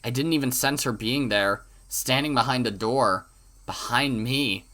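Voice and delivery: ordinary talk, nothing marked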